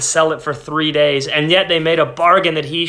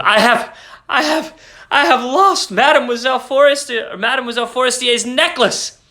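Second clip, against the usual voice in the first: high, breaking rush of panic; panicked confession; 8 semitones higher than usual. A narrator's reading. Wife confessing the lost necklace to her husband, panicked and high. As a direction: stammer it out in rising dread. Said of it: "She turned madly towards him".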